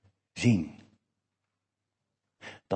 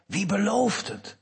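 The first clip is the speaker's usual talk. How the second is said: higher, slow and searching, stress on belooft; faith's question; 10 semitones higher than usual. Shown as a demonstration. Preacher contrasting two questions to the congregation, slow and searching. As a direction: ask Wie belooft het slowly, trusting the giver.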